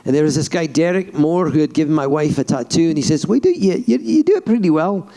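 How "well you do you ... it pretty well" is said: high-pitched Scottish brogue; Scottish accent. Retold or imitated. Scottish tattooist judging an accent, Scottish accent. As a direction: quote a Scotsman in his accent. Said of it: "I did a Scottish voice".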